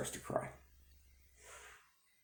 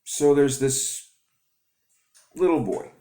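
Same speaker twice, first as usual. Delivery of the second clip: high, slow, louder story start; storyteller opening; 8 semitones higher, 7 dB louder, slower than usual. Acted. Starting her own story for viewers, slow storybook voice. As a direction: open the tale like a children's storyteller. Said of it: "maybe I need to start with a story".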